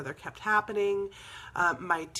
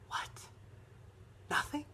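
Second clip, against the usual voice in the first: soft, stunned questioning; hushed disbelief; 12 dB quieter than usual. Retold or imitated. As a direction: let it drop almost to nothing in disbelief. Acting out her stunned reaction.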